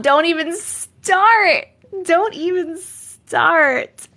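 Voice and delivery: high, loud, very drawn-out warning; mock warning. Streamer warning chat off soda pop debate, mock-outraged. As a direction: stretch it out in playful outrage.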